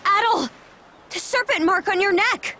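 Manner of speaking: high startled cry; suddenly spot the mark and call out; alarmed. Acted.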